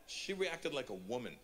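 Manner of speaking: ordinary delivery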